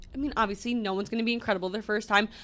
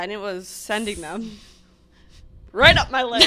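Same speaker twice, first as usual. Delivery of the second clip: usual speaking voice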